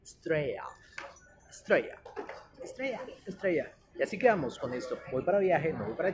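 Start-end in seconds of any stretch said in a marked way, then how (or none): 0.0-2.0 "Estrella" as "Straya", no l; spaced model of "Estrella" with y glide; correct Australian "Straya". Shown as a demonstration. Teacher giving the right form, "Straya". 2.6-3.7 "Estrella" as higher-pitched "Straya"; correct Australian "Straya". Shown as a demonstration. Teacher repeating the right form, high "Straya".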